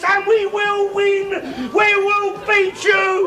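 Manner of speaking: high slow shouted vow; defiant shout. Real, not acted. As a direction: declare victory at full voice. Defiant rant against the miners.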